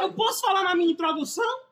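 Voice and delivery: high-pitched exasperated protest; indignant protest. Real, not acted. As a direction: push back at being talked over. Talked over during his own introduction.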